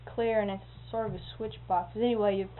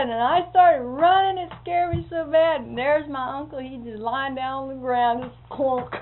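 excited retelling; 4 semitones higher, 8 dB louder than usual. Retold or imitated.

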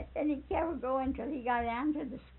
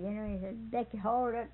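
ordinary talk, nothing marked

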